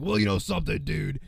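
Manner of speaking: lightly voiced Hogan catchphrase; half-hearted wrestler impression through a sore throat; Hulk Hogan impression. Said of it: "It sounds more like Hogan now".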